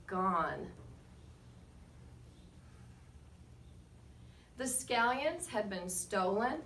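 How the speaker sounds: put-on lively read-aloud to children, rising on names; story narration. A narrator's reading. Reading to the class with lively storybook narration. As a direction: tell it like a picture book to a class. Reads picture book aloud.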